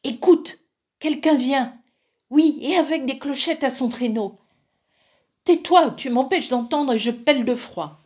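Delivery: two spoiled sisters freezing and complaining; voiced daughters. A narrator's reading. A sister shivering in the forest, whining impatiently.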